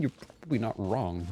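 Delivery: ordinary talk, nothing marked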